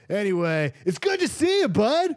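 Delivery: high, friendly character voice; chummy NPC greeting. Acted. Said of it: "And he goes".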